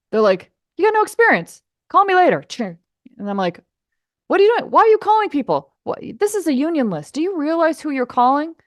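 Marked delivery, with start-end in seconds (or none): 0.8-2.4 high, loud, brush-off voice; snooty caller mocking a newbie; mock dismissive pro. Mocking rude union mixers. 4.3-8.5 high, loud, indignant scolding; hammed-up outrage; mock outraged caller. Mocking angry union mixer.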